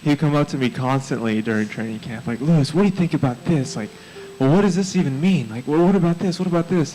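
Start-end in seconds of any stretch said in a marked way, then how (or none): 2.4-6.9 high, insistent string of questions; eager questioning. Retold or imitated. Friend pestering a mentor at training camp, eager questioning. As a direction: pester like a keen, curious friend.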